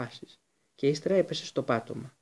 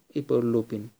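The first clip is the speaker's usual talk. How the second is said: ordinary delivery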